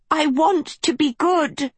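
put-on high, pleading girl's voice; begging. A narrator's reading. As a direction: beg with all her heart. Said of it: "Sophie begged".

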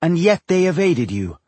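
high scornful jab. Acted. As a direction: stress yet with scorn. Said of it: "she said scornfully".

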